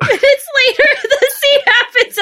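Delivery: high, fast, laughing through words. Real, not acted. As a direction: laugh while telling it. Laughing while telling.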